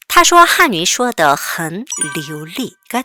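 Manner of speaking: very slow, careful sentence reading; slow read-aloud. Shown as a demonstration. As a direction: read slowly so students can repeat. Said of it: "같이 읽어보겠습니다". (천천히 따라 읽기 시범)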